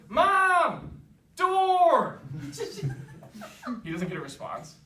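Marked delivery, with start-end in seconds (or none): 0.1-2.2 high, loud yell for mom. Acted. Prince yells for mom. 2.3-3.8 high-pitched giggle fit; high squeal of laughter. Real, not acted. Laughs at own joke.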